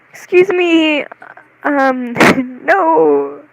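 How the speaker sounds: loud, high-pitched mock indignation; mock offended. Retorting to being called a fairy boy, mock offended. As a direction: protest loudly with a laugh breaking in.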